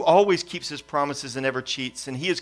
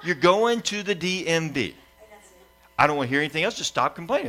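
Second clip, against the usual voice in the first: playful fake sternness toward a complaining kid; stern dad; 4 semitones higher than usual. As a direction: scold the child while laughing. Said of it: "And I'm like".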